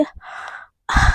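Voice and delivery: a long drawn-out moan; slow breathy moan. Acted. Priyanka moaning under Garima's kisses, slow moan. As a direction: let a sigh of pleasure slip out slowly. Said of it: "सिसकियाँ निकलने लगती है".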